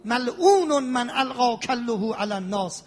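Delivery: ordinary delivery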